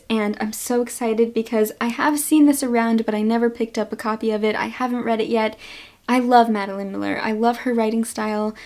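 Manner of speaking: ordinary talk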